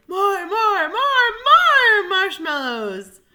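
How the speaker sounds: slow, high, loud demand; lion's cry. Acted. Lion demands more.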